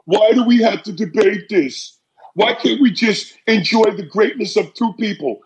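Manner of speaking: a lightly voiced whiny debater; mock the peacemaker in a sing-song; hint of a prissy fan; mocking whiner.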